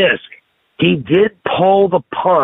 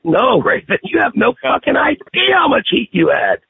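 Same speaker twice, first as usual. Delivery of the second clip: emphatic retort; 11 semitones higher than usual. Retold or imitated. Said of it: "I was like".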